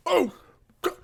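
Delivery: high, loud startled cry; panicked shout. Acted. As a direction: yelp the name in alarm. Struck gnome's panic.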